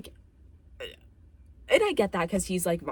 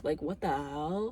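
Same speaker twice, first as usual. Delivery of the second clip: mock disbelief; slower than usual. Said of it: "I'd be like".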